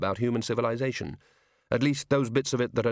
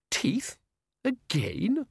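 higher, rising disbelief; not this again; dismayed Susan; 11 semitones higher, much slower than usual. Acted.

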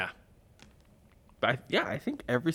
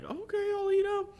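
high mock boy voice. Retold boy agreeing, high voice.

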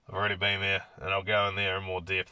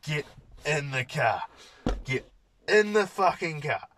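angry order; 6 semitones higher than usual. Retold or imitated. Carer ordering the screaming guy into the car, rising angry command. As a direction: get sterner on each repeat.

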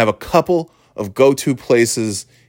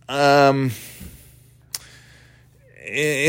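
ordinary delivery

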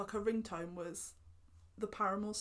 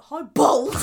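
very loud, raised-pitch mock shout; aggressive greeting; 4 semitones higher, 18 dB louder than usual. Volturi greeting Bella in the film, mock aggressive shout. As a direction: imitate an aggressive villain bellowing the greeting. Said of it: "he says it really aggressively".

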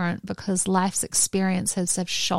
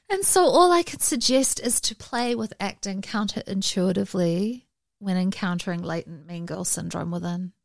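ordinary delivery

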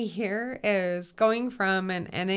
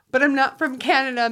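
louder, higher and faster, laughing insistence; emphatic denial; 6 semitones higher, 9 dB louder, faster than usual. Real, not acted. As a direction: set the record straight with a laugh. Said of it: "I'm gonna put it out there".

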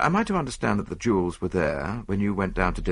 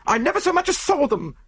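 much higher, louder, indignant chambermaid; heated; 4 dB louder than usual. Acted. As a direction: flare up at the accusation, drawing out the lie. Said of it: "she declared heatedly".

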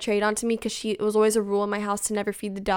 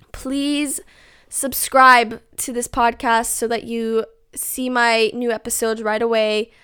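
ordinary talk

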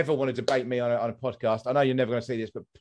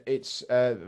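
ordinary delivery

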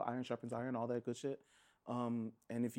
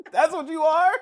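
loud, higher mocking question; incredulous shout; 13 semitones higher, 13 dB louder than usual. Real, not acted. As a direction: fling it out in disbelief. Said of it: "Malcolm was pissed".

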